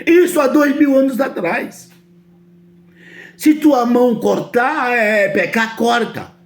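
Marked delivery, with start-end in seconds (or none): none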